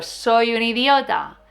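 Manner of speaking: slow, lightly voiced negative thought; slow mock thought. Shown as a demonstration. Quoting the inner critic, slow and slightly voiced. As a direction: say it slowly like the inner voice. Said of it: "Por ejemplo".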